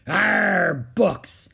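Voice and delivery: loud, drawn-out pirate growl; disgusted pirate. Acted. Captain Bones on hearing books, disgusted growl.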